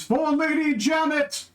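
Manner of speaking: high, slow war cry; battle scream. Acted. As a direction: scream it as you charge. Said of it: "Hervé screams".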